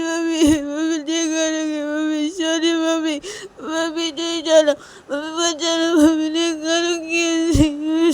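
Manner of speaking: put-on very high, sobbing child's plea; tearful pleading. Liya clinging to mummy and saying sorry, crying and pleading. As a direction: beg forgiveness through loud tears. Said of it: "किस करने लगती है लिया रोते रोते".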